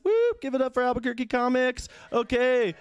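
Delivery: high, whooping emcee call; rally the room with a whoop; mock crowd hype. Comic at a comedy contest hyping the crowd, high whoop.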